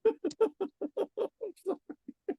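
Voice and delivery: long falling giggle, way up high; squeaky delighted laugh; high squealing laugh. Real, not acted. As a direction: let a shrill giggle spill down. Laughing at the accent.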